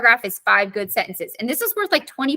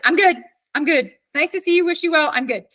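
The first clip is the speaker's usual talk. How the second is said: higher-pitched, breezy retold refusal; polite brush-off; 5 semitones higher than usual. Teacher retelling how she turned down her ex, polite brush-off. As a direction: wave someone off politely, pitch lifted then settling.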